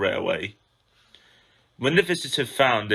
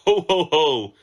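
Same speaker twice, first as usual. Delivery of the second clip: higher, louder hearty chortle; chortling; 5 semitones higher, 6 dB louder than usual. A narrator's reading. Henry hears the crash story, loud gleeful chortle. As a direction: laugh heartily at his misfortune. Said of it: "chortled Henry".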